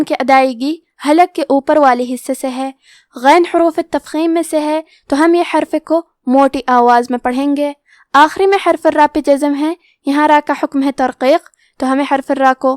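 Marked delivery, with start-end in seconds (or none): none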